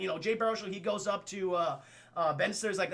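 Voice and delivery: usual speaking voice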